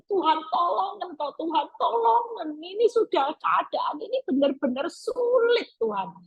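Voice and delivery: high, pleading prayer cry; desperate plea. Retold or imitated. Preacher acting a desperate believer begging God. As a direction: beg God with all your heart. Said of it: "orang memohon dengan sangat itu dengan segenap hati".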